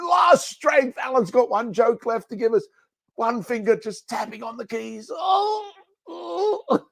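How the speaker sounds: high, hammed-up feeble wail; comically strained high voice, groaning at the end; mock last-gasp strain. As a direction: act out using your last strength. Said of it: "when he's not feeling a hundred percent".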